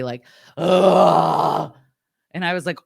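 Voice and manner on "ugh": a loud, exasperated "ugh" grunt; audible angry grunt. Retold or imitated. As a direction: grunt it out like an irritated pool guy. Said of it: "like, audibly".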